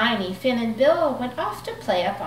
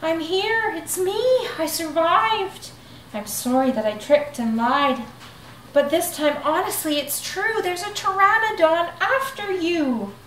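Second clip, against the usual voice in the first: muffled cry; 8 semitones higher than usual. Acted. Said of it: "a muffled cry".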